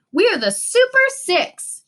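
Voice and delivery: high, slow, triumphant cry. A narrator's reading. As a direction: proclaim the team name in triumph. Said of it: "he said triumphantly".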